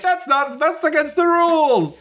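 very high, drawn-out cry of protest; mock yell. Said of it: "who yells when they take off".

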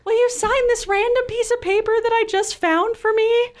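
very high, loud, tearful squeal; hammed-up starstruck squeak, near laughing; nervous fangirl. Young fan asks rock star for autograph, nervous fangirl squeal. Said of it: "nervous fangirl".

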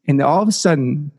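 normal talking voice

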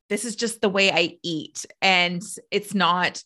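normal talking voice

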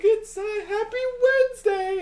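very high, slow sing-out announcement; mock cheery mom. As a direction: chirp it in an exaggerated falsetto. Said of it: "why can't she be like".

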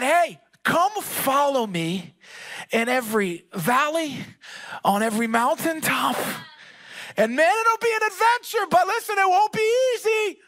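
high, loud sermon climax; full-force preaching. Real, not acted. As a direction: preach it out to the whole room, pitch climbing.

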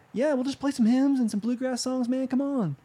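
light, high, easygoing buddy voice; mock the laid-back musician's invite; friendly invite.

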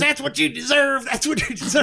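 high voiced movie line; quoting a character. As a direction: quote it in a high voice.

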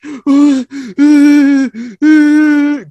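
loud, high dramatic gasp; hammed-up gasp of shock; big theatrical gasp; sharp mock gasp. Victim's reaction retold.

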